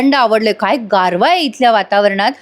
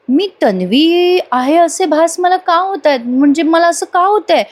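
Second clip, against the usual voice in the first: higher, slow, puzzled inner voice; Sayali lost in thought; 6 semitones higher than usual. Acted. Sayali asking herself about the visions, slow and puzzled. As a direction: wonder aloud slowly, as if haunted. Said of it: "Sayali आता विचारात पडते".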